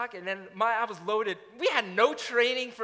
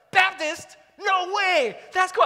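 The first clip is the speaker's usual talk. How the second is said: much higher and louder, gushing with delight; overeager excitement; 11 semitones higher, 10 dB louder, slower than usual. Retold or imitated. Preacher imitating his eager younger self, gushing excitedly. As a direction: pounce on it like great news.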